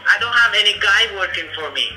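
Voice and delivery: ordinary talk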